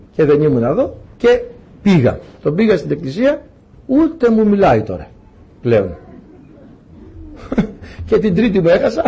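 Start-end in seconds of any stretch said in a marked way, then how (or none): none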